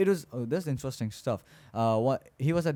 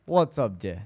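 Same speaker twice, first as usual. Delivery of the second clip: bad Russian accent; 2 semitones lower, 4 dB louder than usual. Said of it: "trying a really bad Russian accent".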